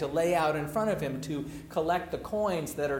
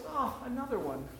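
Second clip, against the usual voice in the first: higher, softer, weary mock exasperation; lightly voiced, fed-up Jesus; exasperated Jesus; 7 semitones higher, 7 dB quieter, much faster than usual. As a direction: sigh it out, fed up. Jesus sighing at disciples.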